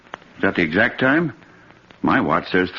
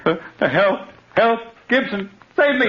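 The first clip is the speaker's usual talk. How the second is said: high panicked screaming; terrified cries for help; 11 semitones higher than usual. Acted. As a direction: scream for rescue. Victim screams for the policeman.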